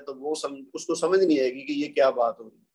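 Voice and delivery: ordinary delivery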